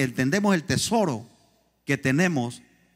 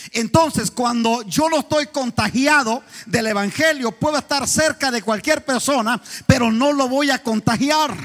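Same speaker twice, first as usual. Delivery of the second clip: loud, raised preaching, pressing the point; preaching at full force; 6 semitones higher, 3 dB louder than usual. Acted. Pastor challenges the congregation about not contagiating others, preaching hard. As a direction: drive it home like a fired-up pastor.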